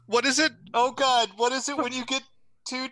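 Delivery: high-pitched, thrilled questioning; excited and high, pausing in amazement; real excitement. Real, not acted. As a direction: raise the pitch, giddy over a lucky roll. Excited over rare dice result.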